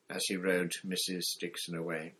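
ordinary delivery

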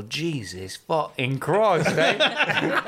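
slow, drawn-out fake British accent; badly faked British accent, breaking into laughter; mock British accent. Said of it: "just to do that accent".

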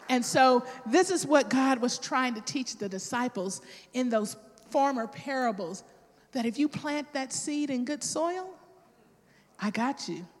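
normal talking voice

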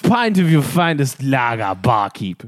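slow, hammed-up posh English order; slow mock-posh British order at the bar. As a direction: order like a pompous old gentleman. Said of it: "That's how they talk".

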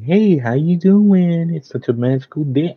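high, sing-song mock-perky Disney adult; cheery voice. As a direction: imitate a syrupy, high-pitched park fan. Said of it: "come with that cheery voice".

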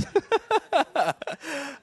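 real high-pitched laugh. Real, not acted. Preacher laughs at own confession.

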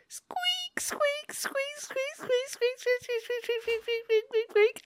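very high, thin squeaky squeals; soft, extremely high-pitched squeaking; female capybara squeak. Retold or imitated. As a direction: squeak like a tiny shrill rodent, over and over. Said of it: "The female capybara is gonna be like".

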